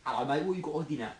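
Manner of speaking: quick Cockney patter; Cockney accent. Shown as a demonstration. Friend requests a London dialect, Cockney accent. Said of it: "Cockney, do Cockney".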